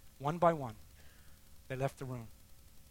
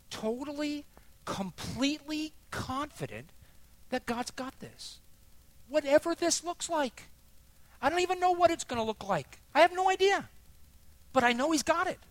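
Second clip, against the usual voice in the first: high, fervent declaration building louder; impassioned testimony; 13 semitones higher, 6 dB louder than usual. Real, not acted. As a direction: proclaim total confidence with soaring pitch. Impassioned testimony of faith in God.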